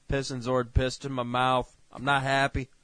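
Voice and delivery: ordinary talk, nothing marked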